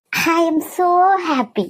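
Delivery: very slow, drawn-out gloating; gloating delight. Acted. Rival has just been humiliated, gloating delight. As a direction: savour every word, stretching it right out.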